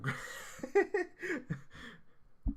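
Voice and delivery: long high breathy laughing fit; can't stop laughing. Real, not acted. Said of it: "Why am I laughing so much?".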